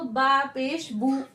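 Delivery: slow बा पेश बू, clean ऊ; बू rounded, Arabic style; Arabic oo sound. Shown as a demonstration. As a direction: spell it slowly, बू with oo. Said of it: "ऊ का साउंड आएगा".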